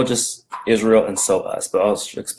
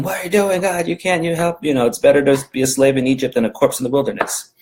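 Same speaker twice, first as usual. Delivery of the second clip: mock yelling; 6 semitones higher than usual.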